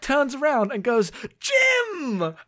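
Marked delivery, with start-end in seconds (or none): 1.4-2.3 high, delighted shout of the name; joyful cry. Retold or imitated. Spock sees his captain alive, joyful cry. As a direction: burst out with glad relief at the sight.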